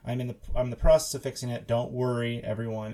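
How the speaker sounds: usual speaking voice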